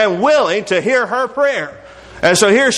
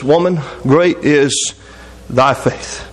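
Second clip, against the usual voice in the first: low, slow and weighty quote; slow solemn praise; 5 semitones lower, much slower than usual. Retold or imitated. Jesus praises the woman's faith, slow and solemn. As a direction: let each word land slowly, pitched low.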